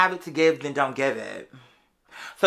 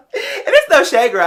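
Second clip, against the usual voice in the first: laughing; 9 semitones higher, 7 dB louder, slower than usual. Real, not acted. Laughing at her fake bags.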